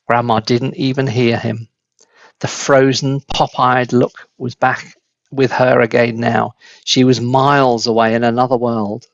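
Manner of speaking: usual speaking voice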